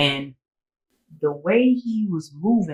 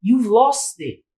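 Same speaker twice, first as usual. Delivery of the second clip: exasperated dismissal; 10 semitones higher than usual. Retold or imitated. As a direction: wave him off for good. Refusing to call back.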